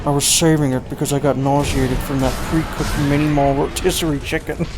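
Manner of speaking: lightly put-on movie-star impression, drawn through one long line; Nick Cage. Streamer straining through a tongue-twisting movie line, celebrity impression. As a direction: attempt a celebrity voice, not much changed in pitch. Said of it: "concentrate on the Nick Cage voice".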